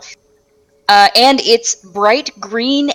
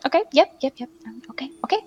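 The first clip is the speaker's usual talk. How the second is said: soft quick yep yep; muttering to herself; 6 semitones higher, 8 dB quieter, much faster than usual. Acted. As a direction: mutter while sorting the loot. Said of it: "She's kinda like".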